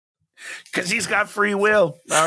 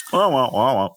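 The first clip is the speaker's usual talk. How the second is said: Snoopy adult voice; 5 semitones lower than usual. Retold or imitated. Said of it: "like the Snoopy adult characters".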